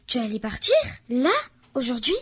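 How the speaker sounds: plain reading voice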